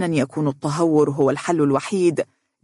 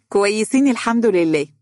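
surprised, smiling; 5 semitones higher, 3 dB louder than usual. A narrator's reading. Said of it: "بدهشة ممزوجة بابتسامة خفيفة".

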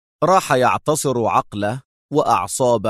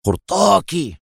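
higher-pitched, heated single word; angry exclamation; 4 semitones higher than usual. A narrator's reading. Captor discovering the captive's earrings, angry. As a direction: burst out with it, pitch raised. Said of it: "وفي غضب قال مستر إكس".

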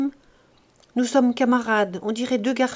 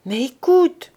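higher, louder call to be heard; a sudden raised plea; cry out; 6 semitones higher, 7 dB louder, slower than usual. A narrator's reading. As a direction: cut through her sobbing. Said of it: "cria Pauline".

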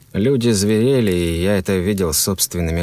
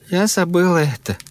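high voice of a bewildered woman; confused woman; 11 semitones higher than usual. A narrator's reading. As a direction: voice her high and lost.

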